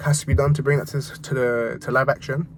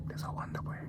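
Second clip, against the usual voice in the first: whispered aside; hushed secret aside; 18 dB quieter than usual. Real, not acted. Repeats it in a whisper.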